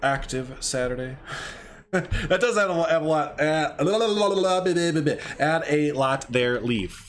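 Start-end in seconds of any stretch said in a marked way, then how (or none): 1.9-6.6 tongue-tied, repeating "add a lot" and restarting; stumbling repeat. Real, not acted. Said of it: "I don't know why that was a hard thing to say".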